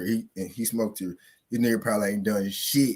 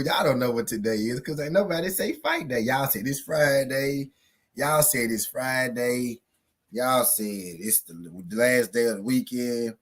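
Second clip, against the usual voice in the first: usual speaking voice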